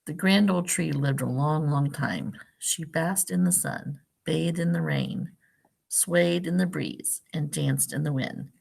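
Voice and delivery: gentle storybook read-aloud; picture-book narration for a class; reading to children. Acted. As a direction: read it like story time for little kids.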